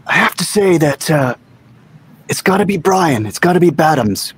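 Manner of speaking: put-on voice with falling phrase ends; Mutt Lange. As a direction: do a playful impression of a record producer. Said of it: "Was that your Mutt Lange voice".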